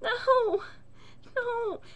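high, loud, crying-rant; hysterical whiny yell. Retold or imitated. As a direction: wail it in disgust. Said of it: "I'm like".

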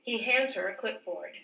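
ordinary delivery, nothing marked

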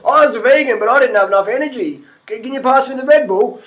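mock someone blaming veganism while asking for Red Bull; whiny ex-vegan.

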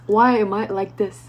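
fuller-voiced lament; exasperated question to oneself; emphatic self-reproach. Real, not acted. Same question repeated, quiet then loud.

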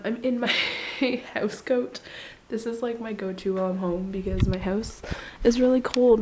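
usual speaking voice

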